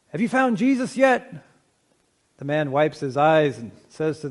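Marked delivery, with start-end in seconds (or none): 0.1-1.3 high-pitched, exasperated preacher's demand; harsh tone. Acted. Preacher to man after third dunking, harsh tone. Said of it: "says in a kind of harsh tone".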